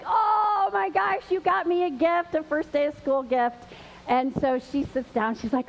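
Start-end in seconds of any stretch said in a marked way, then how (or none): 0.1-3.6 mock surprise. Teacher receiving a first-day gift, acting surprised. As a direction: act delighted and surprised, starting high. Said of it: "You act surprised, and you're like".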